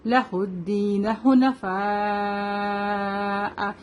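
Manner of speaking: حنفاء with the final "aa" held very long; slow chant, stretching the end of حنفاء; long madd. Shown as a demonstration. Teacher demonstrates five-to-six counts, long madd. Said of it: "five to six count لمبا کریں".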